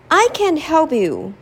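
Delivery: the little mouse offering to help the lion; eager mouse. Acted. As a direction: step up bravely, tiny but sure.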